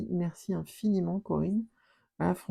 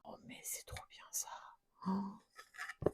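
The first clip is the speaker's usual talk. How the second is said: delighted breathless whisper; hushed whisper of delight; 3 semitones higher, 18 dB quieter, slower than usual. Real, not acted. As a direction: whisper it like a secret treasure. Delighted gasp while unboxing.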